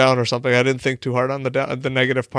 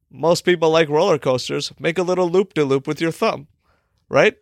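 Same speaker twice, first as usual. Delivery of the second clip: ordinary talk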